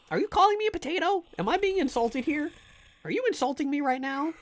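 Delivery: high-pitched indignant questioning; mock offended. Streamer asking chat if they insulted him, mock offence.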